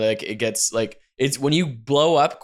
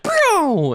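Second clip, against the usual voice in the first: whooshing pew; 6 dB louder than usual. Retold or imitated. Imitating something shooting up.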